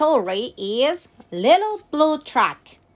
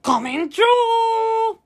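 stretched shout on a raised pitch; yelled; 7 semitones higher than usual. A narrator's reading. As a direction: yell it out like a truck pushing past. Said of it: "yelled the dump truck".

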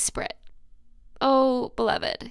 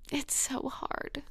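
hushed whisper; 3 semitones higher, 9 dB quieter, slower than usual. Real, not acted. Whispers on hardship.

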